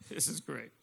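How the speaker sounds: very low, hushed, real choke; emotional, choked up. Real, not acted. Storyteller apologising to the audience, choked up and hushed. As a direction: fight back real emotion, barely voiced. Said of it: "I get emotional with this shit".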